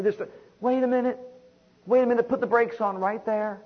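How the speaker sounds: high, emphatic halt; urgent interruption. Acted. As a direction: cut in sharply to stop someone. Breaks in to halt the complainer.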